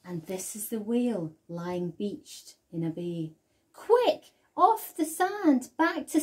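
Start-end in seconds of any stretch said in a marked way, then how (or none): 3.7-6.2 much higher, loud, urgent; urgent cry. A narrator's reading. Snail crying out to the beached whale, urgent. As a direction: hurry the whale back to sea. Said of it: "cried the snail".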